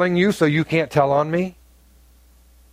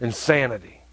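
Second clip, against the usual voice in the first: quiet verdict; 7 semitones lower, 5 dB quieter than usual. Real, not acted. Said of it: "repeated verdict".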